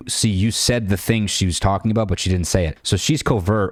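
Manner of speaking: ordinary delivery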